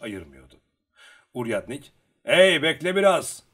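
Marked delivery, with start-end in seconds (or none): 2.2-3.4 loud, raised-pitch holler; shouting. A narrator's reading. Uryadnik telling Luka to wait, shouting. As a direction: call out to stop someone. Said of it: "diye bağırdı".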